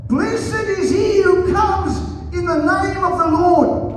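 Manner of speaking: high, slow, drawn-out acclamation; crowd's shout. A narrator's reading. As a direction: cry it out like a cheering crowd.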